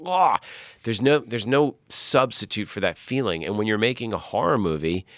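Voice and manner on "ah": a sudden high, loud yelp of shock; startled yelp. Retold or imitated. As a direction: yelp as if cold syrup hits your head. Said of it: "you're like".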